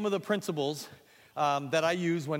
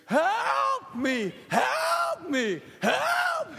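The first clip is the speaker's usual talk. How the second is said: loud rising cries for help; big dramatic cries; 6 dB louder, much slower than usual. Shown as a demonstration. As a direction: blow up the cries. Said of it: "Everything's blown up".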